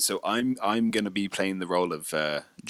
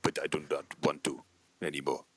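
low, softer fake Bulgarian refusal; mock Bulgarian accent; 5 semitones lower, 6 dB quieter than usual. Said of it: "He sounds Russian".

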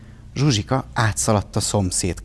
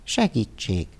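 droop the call for help, almost giving up; even more melancholy; slower than usual. A narrator's reading. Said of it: "még mélabúsabban folytatta".

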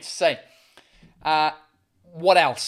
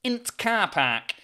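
very slow Yorkshire "Int car park"; broad, drawn-out Yorkshire demo; Yorkshire accent; much slower than usual. Shown as a demonstration. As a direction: show the Yorkshire contraction, "Int" for "in the". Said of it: "it's just int".

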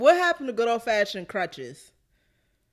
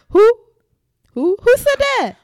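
shrill falsetto, loud and high; high squeal; 13 semitones higher, 12 dB louder than usual. Pretend. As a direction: squeal it like a viral meme. Quoting a meme catchphrase.

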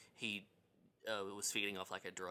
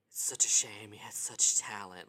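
high camp whispered diva line; campy diva; 7 dB louder than usual. Retold or imitated. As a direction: serve it like a camp movie star. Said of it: "it's so high camp".

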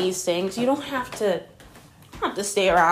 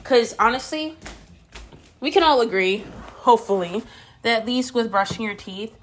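ordinary delivery

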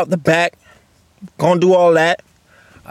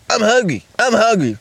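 Miller imitation; 5 semitones higher than usual. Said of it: "this guy's like".

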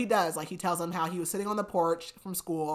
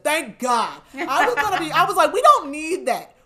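loud, high-pitched outburst of relief; exasperated, relieved exclaiming; exclaimed relief; 9 semitones higher, 10 dB louder than usual. Retold or imitated. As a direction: blurt it out, much louder and higher. Emphatic retelling of own relieved reaction.

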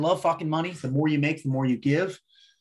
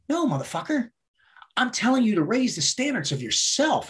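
higher-pitched, insistent correction; emphatic retort; 7 semitones higher than usual. Retold or imitated. Boss correcting his employee on standards, high emphatic retort. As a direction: imitate a mentor setting someone straight.

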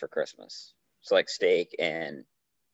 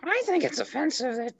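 much higher, strained cartoon housewife voice; Marge Simpson impression. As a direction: squeeze into Marge's high nasal whine. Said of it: "you might as well just give it to the people".

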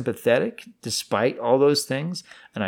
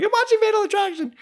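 very high, fast panicked squeak; pre-puberty squeal; 4 dB louder, faster than usual. Retold or imitated. Startled son explaining himself to dad, squeaky. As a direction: squeal it like a boy before his voice breaks. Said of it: "You hadn't gone through puberty yet?".

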